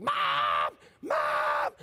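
put-on loud calls of "Mom! Mom!" from a kid; kids yelling Mom. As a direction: shout for mom like a kid across the house. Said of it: "they're like".

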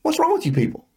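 high, incredulous scolding; exasperated disbelief. Retold or imitated. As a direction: ask it like you can't believe them. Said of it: "I'm looking at them going".

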